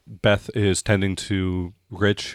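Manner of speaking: ordinary talk, nothing marked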